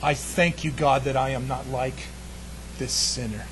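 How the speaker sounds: low, soft self-satisfied prayer; lightly voiced proud priest; smug Pharisee. Pharisee praying to God, quietly smug and low. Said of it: "he said".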